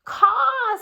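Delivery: high, loud mock caw; mother crow cawing to her babies. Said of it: "said the mother".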